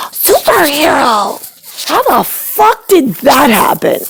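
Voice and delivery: high, breathless gasp into Superhero; a son gushing over his dad; mock awestruck gasp. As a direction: ham up dazzled hero worship. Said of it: "and you're like".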